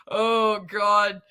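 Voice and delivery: usual speaking voice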